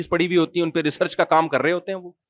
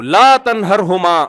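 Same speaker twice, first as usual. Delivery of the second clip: Quranic recitation; 4 dB louder, much slower than usual. Shown as a demonstration. Quoting the Quran again.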